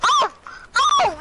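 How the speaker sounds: high, sharp "oh" cries; startled yelps. Real, not acted. Player startled mid-fight, yelping. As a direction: yelp upward in alarm.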